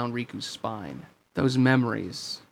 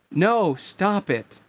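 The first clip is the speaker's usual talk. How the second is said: higher, drawn-out plea; mock scream; 6 semitones higher, 4 dB louder, much slower than usual. A narrator's reading. Girl begging the replica to stop, a restrained scream. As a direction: plead slowly with a raised, understated cry. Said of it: "Namine screamed".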